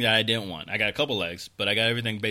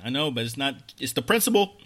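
ordinary delivery

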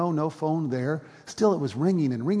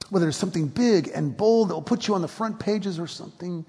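rising, louder pastoral appeal; preaching at full force; 5 semitones higher, 4 dB louder than usual. Acted. Pastor challenging the congregation, fervent preaching. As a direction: press the congregation with urgent questions.

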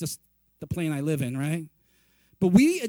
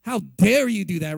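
mock outrage; 7 semitones lower, 6 dB louder than usual. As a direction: act shocked and self-righteous at the cheater. Mocking outrage at a friend's affair.

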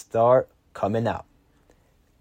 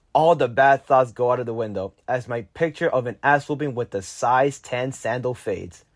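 ordinary delivery, nothing marked

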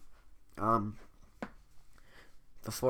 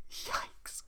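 whispered yikes; 9 dB quieter than usual. Real, not acted. Reacts to Fiona's remark.

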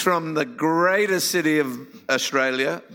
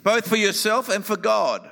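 raised, earnest sermon voice; preaching; 5 semitones higher than usual. Acted. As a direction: preach it to the congregation. Preaching through an interpreter.

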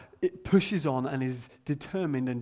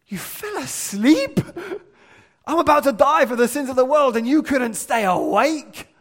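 high, loud, exasperated rant; mock exasperated outburst; 9 semitones higher, 10 dB louder than usual. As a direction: play it as an impatient, fed-up Jesus. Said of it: "You know what I would have done if I was Jesus?".

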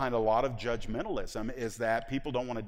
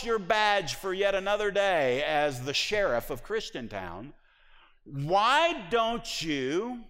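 louder and higher, pressing each clause hard; urgent challenge; 7 semitones higher, 4 dB louder, slower than usual. Retold or imitated. Preacher voicing Jesus to the congregation, urgent and loud. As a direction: confront the listener head-on.

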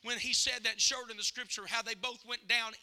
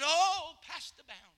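put-on an "Oh" lifted high in her accent; imitated parishioner's accent; 5 semitones higher, 4 dB louder, slower than usual. Dying woman calls her pastor, imitated accent. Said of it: "In her accent".

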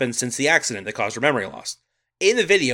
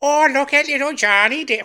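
high, louder mock Irish accent; 11 semitones higher, 3 dB louder than usual. Host slipping into a mock Irish announcer voice. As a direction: do a hammy Irish sportscaster. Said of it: "why did I just go full Irish".

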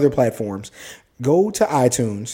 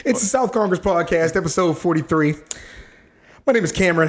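higher-pitched host outro; podcast sign-off; 5 semitones higher than usual. Real, not acted. Host closing out episode forty-three, raised announcer-style sign-off.

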